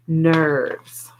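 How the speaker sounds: ordinary delivery, nothing marked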